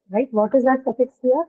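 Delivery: ordinary delivery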